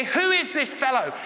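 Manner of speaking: high-pitched mild indignation; lightly acted angry challenge; agitated religious leaders. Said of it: "they get agitated and angry".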